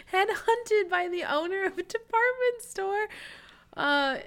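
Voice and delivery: high-pitched, helpless giggly delivery; laughing while talking. Real, not acted. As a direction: squeeze the words out through real laughter. Cracks up while retelling the job offer.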